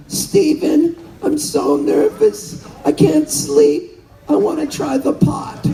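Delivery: a fluttery, high imitation of an eighty-eight-year-old mom; nervous mom. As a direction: play your worried mother asking shyly. Retold mom quote, nervous.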